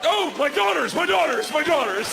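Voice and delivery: repeated despairing cry; exasperated wail. Real, not acted. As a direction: wail it like a burdened dad. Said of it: "Okay, here we go".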